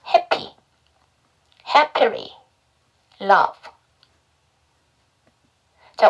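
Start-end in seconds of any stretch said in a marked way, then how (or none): 0.1-3.6 "happy", "happily", "love" one at a time, carefully separated; repeat-after-me model. Shown as a demonstration. Teacher leading learners in practice, repeat-after-me "happy, happily, love". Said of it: "저랑 같이 한번 연습해봐요".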